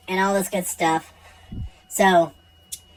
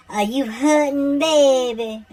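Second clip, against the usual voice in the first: slow, higher, tender nurse's question; caring nurse; 8 semitones higher, 3 dB louder, much slower than usual. Retold or imitated. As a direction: coo it like a kind nurse. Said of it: "She was like".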